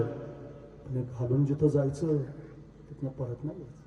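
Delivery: slow, very soft and halting; grave warning. Acted. King telling Shiva there is no return, grave hushed warning. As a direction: let the warning sink almost to nothing.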